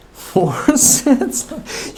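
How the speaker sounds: breaks into laughter mid-word; reading cut off by a real laugh. Real, not acted. Reader laughs mid-read.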